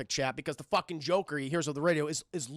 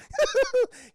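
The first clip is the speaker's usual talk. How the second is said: high, loud gleeful cackle; villain giggle; 7 dB louder than usual. Acted. The Joker constantly behind Charlie, cackling. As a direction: giggle manically.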